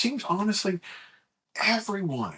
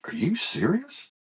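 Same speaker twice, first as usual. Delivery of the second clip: slow, high-pitched query; careful, high check that it's true; retold incredulous question; 10 semitones higher, slower than usual. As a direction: ask it slowly, rising in disbelief. Brother calls back, asks in earnest.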